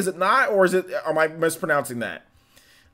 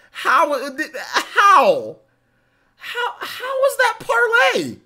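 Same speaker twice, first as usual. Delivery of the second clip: stammering, high-pitched exasperated cry; incredulous yelling; 5 dB louder, much slower than usual. Real, not acted. Streamer disputing a viewer's spelling, incredulous yelling. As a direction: break into a drawn-out, baffled yell.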